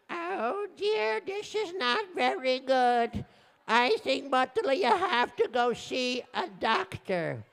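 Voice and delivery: high, slow falsetto wife; concerned wife. Acted. Wife telling her husband to see a doctor, high and concerned. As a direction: voice a worried wife, drawn out. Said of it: "his wife said".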